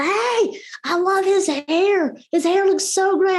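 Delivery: mock yell of delight to a parent far away; calling across the store. Teacher calling across Publix to a parent, cheerful high call. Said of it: "I'm, like, yelling across".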